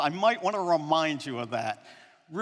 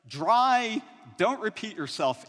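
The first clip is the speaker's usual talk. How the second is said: ordinary delivery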